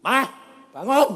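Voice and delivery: short, high shouted order; loud two-word command; simple wake-up command. Retold or imitated. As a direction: call out sharply to rouse mother. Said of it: "Saya cuma gitu".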